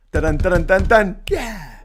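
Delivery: loud spoken drum flourish; mock punchline sting. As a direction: drum out your own joke ending. Mimics a punchline sting after joke.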